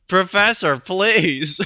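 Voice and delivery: loud, high melodramatic plea; shouting. Retold or imitated. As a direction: launch into an over-the-top shout. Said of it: "he's literally shouting in his speech bubbles".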